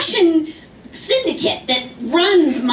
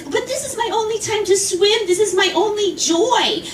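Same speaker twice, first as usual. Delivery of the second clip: put-on higher, pleading old-woman whine; 6 semitones higher than usual. Woman to the swimmer, a higher pleading whine. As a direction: beg for her pool time with a whine. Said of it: "She says".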